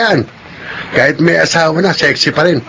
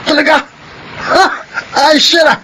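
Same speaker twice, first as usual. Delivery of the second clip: startled exclamation; 10 semitones higher than usual. Acted. Friend shocked on hearing Dario has a gun. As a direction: blurt it out in alarm, much higher.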